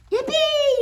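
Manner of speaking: very high, loud cheer; yelled. Acted. Kids cheering about ice skating, loud high yell. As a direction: whoop with joy. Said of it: "yelled Froggy".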